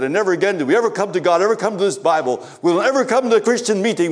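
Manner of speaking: ordinary delivery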